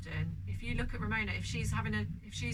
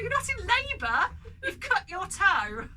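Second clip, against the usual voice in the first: high, loud laughing exasperation; exasperated mum; 11 semitones higher, 7 dB louder than usual. Retold or imitated. Mum retorts to her dramatic daughter, laughing exasperation. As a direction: scoff at the drama, half laughing. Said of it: "I was like".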